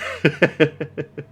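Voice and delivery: light, high run of chuckles; amused laugh. Real, not acted. Coach amused by a player's move, amused laugh.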